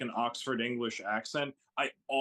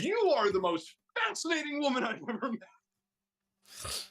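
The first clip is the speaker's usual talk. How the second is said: higher, gushing admiration; 10 semitones higher than usual. Real, not acted. Praising the neuroscientist woman, higher and gushing. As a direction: gush over her.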